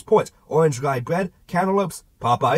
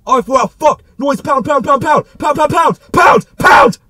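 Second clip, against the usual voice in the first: shouting; 11 semitones higher, 11 dB louder, slower than usual. Acted. Narrator shouting at charging deer.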